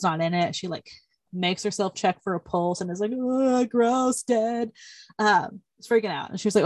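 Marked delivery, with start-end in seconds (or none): 3.1-4.7 higher, grossed-out groan of words; mock recoiling in disgust; imitating a squeamish reaction; retold disgust. Retold disgust at touching a corpse.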